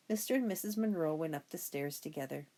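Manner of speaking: ordinary delivery, nothing marked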